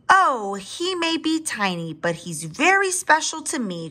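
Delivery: high, protective mother's exclamation; crying out fondly. A narrator's reading. Mother dinosaur defending her tiny baby, crying out. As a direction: pipe up high in the baby's defence. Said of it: "cried the mother dinosaur".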